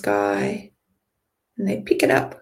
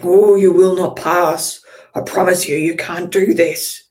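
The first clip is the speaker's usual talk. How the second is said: booming troll; 9 dB louder than usual. Acted. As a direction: bellow it like a troll blocking the way. Troll at gate blocks path.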